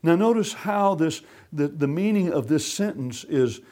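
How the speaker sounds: ordinary talk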